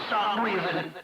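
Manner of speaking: usual speaking voice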